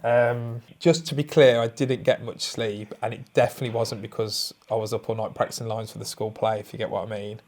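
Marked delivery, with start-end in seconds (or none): none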